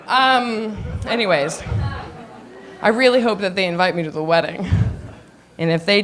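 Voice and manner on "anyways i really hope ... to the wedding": usual speaking voice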